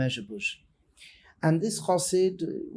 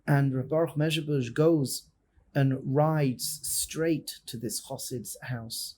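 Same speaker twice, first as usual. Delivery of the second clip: ordinary talk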